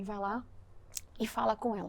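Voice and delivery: hushed, gentle divine voice; quiet inner prompting; soft voice of God. Retold or imitated. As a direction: speak it softly like a voice within. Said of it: "Aí Deus falou pra mim".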